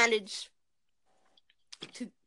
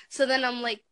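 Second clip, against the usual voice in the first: normal talking voice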